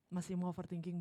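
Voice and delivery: normal talking voice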